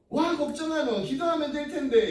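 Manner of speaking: high-pitched Gyeongsang lilt, imitated; retold reassurance in lively Gyeongsang dialect. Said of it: "이게 어디 사투리인가요? 경상도인가요?".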